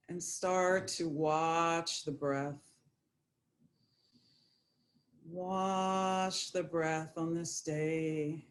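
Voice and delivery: unhurried, even, calming guidance; guided meditation. Acted. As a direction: settle listeners gently, drawing out key words.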